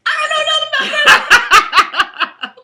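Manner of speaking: high, very loud, slow wail; mock shriek. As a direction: shriek it in comic panic, drawn out. Joke quote, shrieked high and loud.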